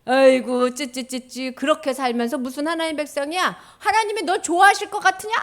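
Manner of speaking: loud, scornful telling-off; abusive scolding. Acted. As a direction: berate the priest as an accuser, tutting. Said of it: "막 이러면서 막 욕을 하는 거예요".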